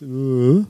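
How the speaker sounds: low, sulky grunt; a grudging, unwilling uh; sulky grunt of a grudging worshipper; reluctant uh. Retold or imitated. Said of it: "stick your tongue out 'cause it's".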